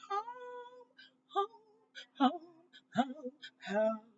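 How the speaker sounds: high drawn-out pleading home; repeated home, starting high and sliding down; whiny longing. Real, not acted. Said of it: "repeating home longingly".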